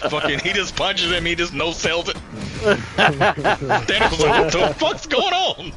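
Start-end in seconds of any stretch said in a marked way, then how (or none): 2.6-5.7 high, slow bewildered cry; a villain who can't believe it; baffled Thanos. Retold or imitated. Friend voicing Thanos shocked by the punch, high and baffled.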